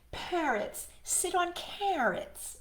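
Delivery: storybook cat lecturing. Acted. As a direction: answer like a know-it-all cat. Cat answers, higher voice.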